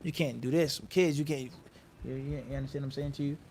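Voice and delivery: usual speaking voice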